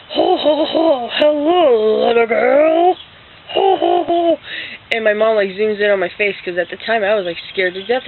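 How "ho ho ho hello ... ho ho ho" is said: booming, drawn-out, high-pitched Santa ho-ho-ho; loud, slow, hammed-up Santa greeting; dad as Santa. Dad surprises little girl dressed as Santa, booming Santa voice. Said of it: "my dad comes in my room".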